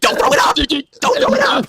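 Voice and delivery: high, rising yell; mock shrill order. Wife ordering choking husband, high mock yell.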